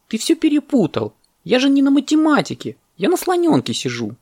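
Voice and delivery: very high, insistent monkey voice; protesting monkey. Acted. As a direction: argue back in a high squeal. Monkey argues with the parrot.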